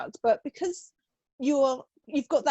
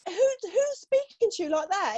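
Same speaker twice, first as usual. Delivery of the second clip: imitating husband cross; 9 semitones higher, slower than usual. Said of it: "And he went".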